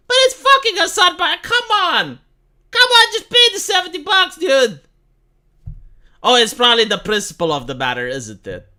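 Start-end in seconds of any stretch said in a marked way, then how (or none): 0.1-4.8 much higher and louder, fed up; exasperated outburst. Real, not acted. Streamer yelling at a millionaire over cab fare, exasperated. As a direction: yell it like you can't believe him.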